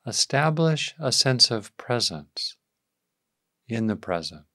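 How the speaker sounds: calm, unhurried meditation voice; guided meditation. Real, not acted. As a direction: ease listeners into awareness, leaving space after each phrase.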